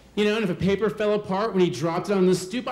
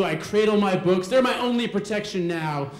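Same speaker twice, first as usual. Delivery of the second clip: normal talking voice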